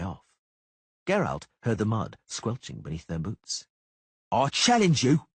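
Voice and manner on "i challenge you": higher, louder, insistent; shouted challenge; repeated challenge. A narrator's reading. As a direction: hammer the challenge again. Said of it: "Cicada repeated".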